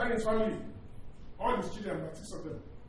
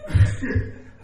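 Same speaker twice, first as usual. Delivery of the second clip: laughing; 7 dB louder than usual. Real, not acted. Laughing while telling his wife.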